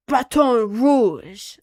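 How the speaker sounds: slow, loud, fancy French Baton Rouge; French accent. Shown as a demonstration. Streamer to chat, saying Baton Rouge in a showy French accent. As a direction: say Baton Rouge like you're really French, savoring it. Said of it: "like, really French and saying that".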